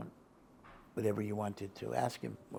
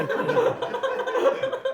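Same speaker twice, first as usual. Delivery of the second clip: laugh; 10 dB louder than usual. Real, not acted. Laughing after retelling the yelling.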